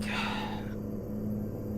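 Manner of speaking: long, soft, weary exhale; reluctant sigh. Retold or imitated. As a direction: sigh it out like you'd rather stay home. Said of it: "So I'm like".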